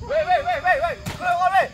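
loud insisting. Acted. Father repeats the demand.

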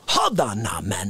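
deep low hold on; low growl reply. Acted.

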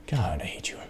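breathy whisper of real annoyance; whispered hate. Real, not acted. Gamer whispering disgust at a stubborn box.

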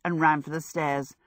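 ordinary delivery

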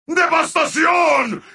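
high, ringing announcer cry; triumphant announcer shout. Acted. Announcer declaring devastation, triumphantly. Bigger take of announcer call.

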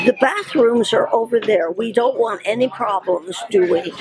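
ordinary talk

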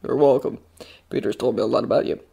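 low, soft, composed reply; smooth. A narrator's reading. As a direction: play it cool, low and easy. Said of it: "says smoothly".

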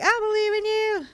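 voicing Sarah. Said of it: "she's like".

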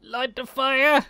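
high, slow, loud cry; drawn-out desperate yell; urgent shout. Acted. Urgent in-game cry, freezing.

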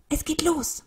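high, excited cry; urgent high-pitched announcement; sudden call. A narrator's reading. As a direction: call out as it all begins. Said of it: "rief Elli plötzlich".

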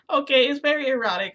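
loud, high, laughing admission; embarrassed laughing. Real, not acted. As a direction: burst out laughing into the words. Laughs while admitting the content.

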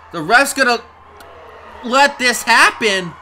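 loud, high indignant protest; incredulous outrage. Real, not acted. Commentator protesting the ref allowing cheating, incredulous outrage. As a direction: raise it in disbelief.

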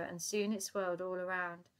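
plain reading voice

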